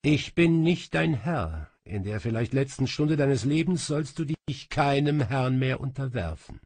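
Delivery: a touch of regal gravity; lightly voiced solemn king. A narrator's reading. King frees his companion in their last hour, lightly solemn. King Salomo releases Asmira from service.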